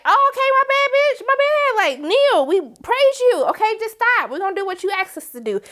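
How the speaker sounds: grovel to save yourself; very high mock panic; scared backpedal. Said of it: "then they're like".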